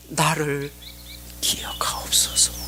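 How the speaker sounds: soft, fading to a whisper; hushed plea. Acted. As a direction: quote the plea under the breath. Recalls the dying criminal's plea. (속삭이듯 작게 간청)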